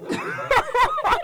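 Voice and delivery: high burst of laughter; loud laughing outburst. Real, not acted. Friends laughing at joke.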